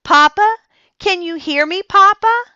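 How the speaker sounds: high, loud call to her father. A narrator's reading. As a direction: call through the closed door. Sarah calls through the door.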